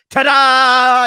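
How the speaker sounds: loud, high magician flourish; magician's ta-da. Retold or imitated. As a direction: announce it like a magician revealing a trick. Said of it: "magicians say".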